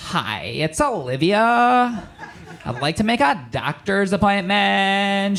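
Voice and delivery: slow, swooping drawn-out impersonation; mock fiancée voice. Man posing as his fiancée to book a doctor, slow mock voice. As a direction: badly imitate her, stretching the vowels. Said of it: "Didn't even do the voice".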